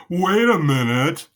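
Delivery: stretched-out "hold on" reaction; mock disbelief. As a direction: stop everyone in their tracks. Mock suspicious reaction, quoted.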